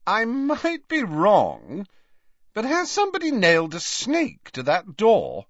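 put-on girl's voice. A narrator's reading. Girl remarks to companion about cottage, high-voiced. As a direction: put on a high-pitched young lady. Girl outside spots nailed snake.

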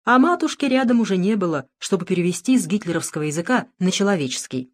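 plain reading voice

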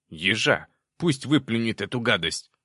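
loud, disgusted demon panic; panicked yell. A narrator's reading. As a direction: shout in alarm as a little demon. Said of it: "в панике заорал Проныра".

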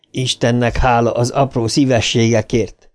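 ordinary delivery

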